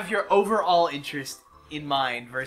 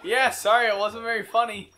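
high, loud mock-polite apology; fake polite apology; 7 semitones higher, 4 dB louder than usual. To a stranger, a high falsely polite apology. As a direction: sound sorry without meaning it.

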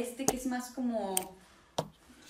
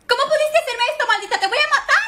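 high furious telenovela outburst; gasping soap opera drama; 12 semitones higher, 11 dB louder, faster than usual. Acted. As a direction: scream it like a betrayed villainess. Soap opera style fight.

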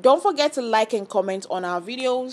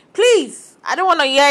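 fed up; 4 semitones higher, 6 dB louder than usual. Real, not acted. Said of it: "I'm literally tired of hearing that".

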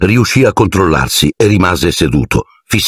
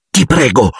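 ordinary delivery, nothing marked

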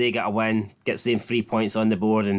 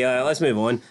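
normal talking voice

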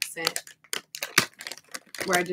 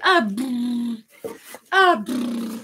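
put-on playful baby babble; 4 semitones higher, 9 dB louder, much slower than usual. Baby noise imitation.

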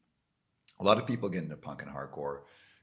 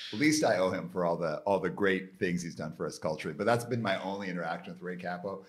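usual speaking voice

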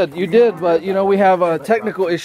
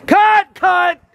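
loud, high shout to stop; exasperated director's shout; calling cut; 10 semitones higher, 5 dB louder than usual. Real, not acted. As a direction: yell it across the set. Director stops the take.

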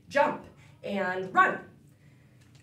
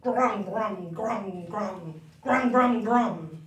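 chewy lower grumbling; hippo chewing; 3 semitones lower, much slower than usual. Pretend. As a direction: chew the sounds like a hippo. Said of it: "a hippopotamus chewing gum".

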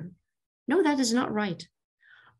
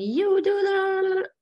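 mock blaming; 12 semitones higher than usual. Mocking a blaming parent.

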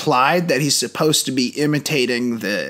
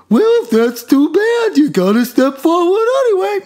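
Hanna-Barbera character; 5 dB louder than usual. Retold or imitated. Mocking the teacher's dub in a squeaky cartoon voice. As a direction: squawk it like a Saturday cartoon. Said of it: "like a Hanna-Barbera character".

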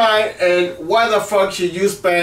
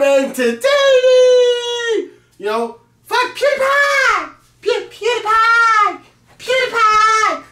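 much higher, slow sung-out yell; mock falsetto shout; slower than usual. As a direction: stretch the name out in a silly high wail. Mocking shout of PewDiePie's name.

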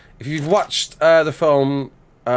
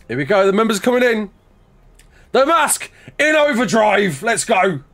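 high, loud hype-man call; excited hype; 7 semitones higher, 4 dB louder than usual. Real, not acted. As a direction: shout it to rally the crowd. Hyping up members coming in.

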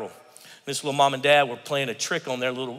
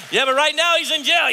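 skeptical shout; 11 semitones higher, 6 dB louder than usual. Acted. Skeptic objecting.

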